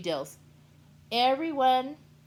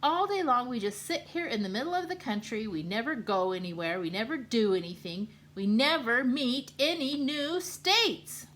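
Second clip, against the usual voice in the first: moaning; 5 semitones higher, 7 dB louder than usual. Acted. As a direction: groan about being stuck in the middle. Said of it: "moaned Kansas".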